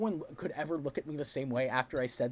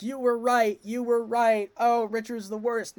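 higher, louder mock gush; hammed-up eager agreement; Queen Elizabeth, gushing; 5 semitones higher, 5 dB louder than usual. Queen Elizabeth gushing to Margaret, mock excited. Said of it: "Queen Elizabeth is like".

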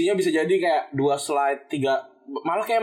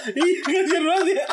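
giggling helplessly; 10 semitones higher than usual. Real, not acted. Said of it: "geli banget gua".

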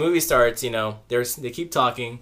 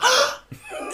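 high mock gasp; shocked gasp. Moviegoers shocked at being told off, hammed-up gasp. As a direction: suck in air in pretend shock. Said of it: "they look so shocked".

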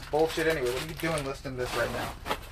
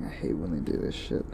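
whispered grumble to self; annoyed whisper while reading numbers; muttered under breath; 10 semitones lower, 6 dB quieter than usual. Real, not acted. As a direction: mutter it unvoiced, just for yourself.

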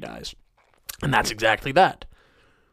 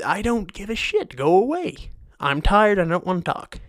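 grumpy rant; 8 semitones higher than usual. Real, not acted. Angry rant at greeters.

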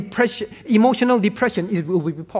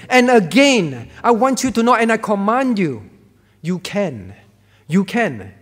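commanding, emphatic; 4 dB louder than usual. Acted. God commanding the believer that they can, booming. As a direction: drive home that they can, forcefully.

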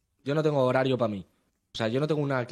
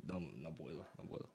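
muttered no; 7 semitones lower, 8 dB quieter, faster than usual. Real, not acted. Insisting he can't stand phones.

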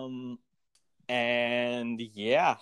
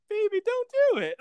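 very high, louder mock mother's plea; pleading mother; 5 dB louder than usual. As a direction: ham up a mother begging her son. Voicing the mother pleading with Mac.

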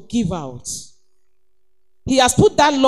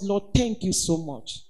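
hushed thanks; 5 semitones lower, 11 dB quieter than usual. Acted. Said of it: "said some words".

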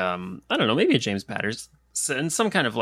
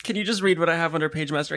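normal talking voice

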